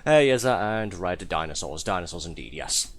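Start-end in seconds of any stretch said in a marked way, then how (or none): 0.0-0.6 louder, brighter hey called out; loud cheerful greeting. Real, not acted. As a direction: call out the greeting with gusto. Greeting a chat viewer again.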